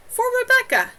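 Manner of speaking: outraged, burst out; angry outburst. A narrator's reading. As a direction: Rebecca furious at Avril, shouting. Rebecca's surge of anger.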